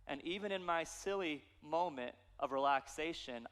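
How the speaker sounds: ordinary delivery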